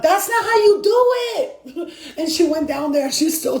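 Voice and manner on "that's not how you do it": high, drawn-out exasperated holler; mock scolding. Guides imitating her bossing the ship, exasperated. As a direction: re-enact a bossy perfectionist calling out, slow and high. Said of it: "talking about".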